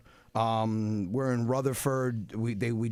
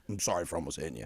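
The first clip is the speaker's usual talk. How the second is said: lower, quieter mumbled sorry; sheepish apology; 10 semitones lower, 7 dB quieter, faster than usual. Retold or imitated. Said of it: "He goes".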